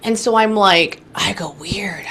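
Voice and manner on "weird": whispered weird. Retold or imitated. Reacting to a strange psychic message.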